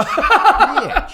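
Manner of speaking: high, loud cry of pain, half laughing; pained outburst. Acted. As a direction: yelp the curse as the punch lands. Punched character curses.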